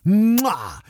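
ordinary talk, nothing marked

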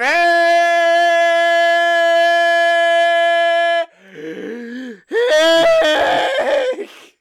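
drawn-out, ear-splitting high shriek; long high scream. Acted. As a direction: answer the introduction with one endless screech. Co-host's answer to his introduction.

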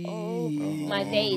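slow, drawn-out exasperated sigh of words. Retold or imitated. Told by the bank her account doesn't exist, slow disbelief. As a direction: let it drag out in disbelief. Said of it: "I was like".

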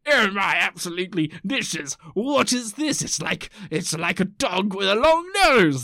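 hammed-up puzzled old-timey voice; old-timey Victorian. Old-timey person puzzling over an unknown animal, hammed-up amazement. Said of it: "the old-timey people going like".

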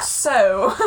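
put-on elderly British lady accent; a hint of an old British woman; older British woman. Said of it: "it's like an older British woman".

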